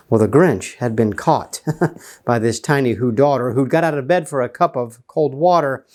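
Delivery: ordinary delivery, nothing marked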